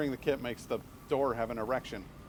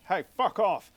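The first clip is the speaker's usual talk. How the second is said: higher, louder booming mock bellow; Matt Berry shout; 7 semitones higher, 9 dB louder than usual. Streamer repeating the IT Crowd line, louder mock bellow. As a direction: bark it like an indignant boss. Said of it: "so I just did it again".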